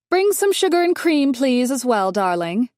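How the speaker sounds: high, loud sing-song call; calling out sweetly. A narrator's reading. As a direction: grande dame calling the maid. Dolores calls to kitchen.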